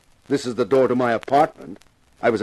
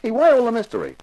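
exasperated; 11 semitones higher, faster than usual. Acted. Detective pushes for answers.

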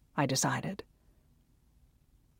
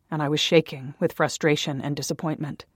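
ordinary delivery

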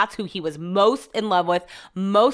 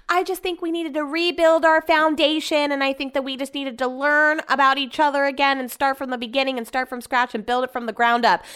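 put-on higher-pitched earnest girlfriend act; put-on higher voice, retelling her speech; mimicked earnest speech; 6 semitones higher than usual. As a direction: rattle it off in a high, sincere-sounding voice. Mimicking Susie's reunion speech.

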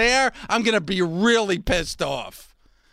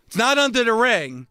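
ordinary delivery

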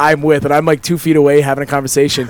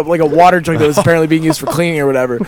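normal talking voice

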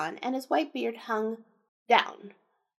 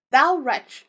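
loud storybook accusation; raised, indignant exclamation; crying out; 7 dB louder than usual. A narrator's reading. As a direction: cry it out at the youth. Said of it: "cried he".

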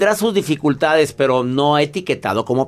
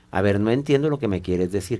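low, soft, unruffled request; composed, lowered voice; calm reply; 6 semitones lower, 5 dB quieter than usual. Acted. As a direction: answer a quarreler softly and evenly. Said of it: "de mantener la calma, le dices".

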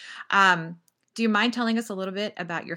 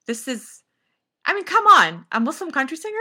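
mock disbelief, jumping much higher and louder; amazed disbelief; 9 semitones higher, 8 dB louder than usual. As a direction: exclaim it like you can't believe your ears. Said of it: "and I was just like".

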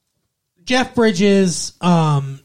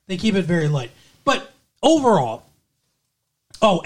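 ordinary delivery

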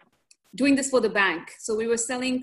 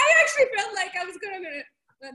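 words bursting out through real laughter; laughing hard; 9 semitones higher, 4 dB louder than usual. Real, not acted. Telling her co-host about the village, laughing loudly. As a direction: laugh your way through the line. Laughing while picturing herself there.

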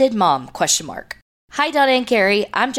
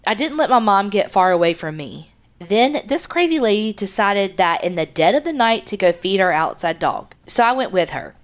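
ordinary delivery

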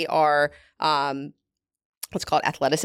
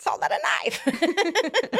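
put-on slow, high Australian accent movie line; 12 semitones higher, much slower than usual. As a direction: ham up a slow Australian accent, much higher. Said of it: "Can you do an Australian accent for us".

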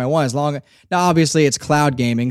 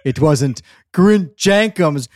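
quoted character; slower than usual. Retold or imitated. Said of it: "he's like".

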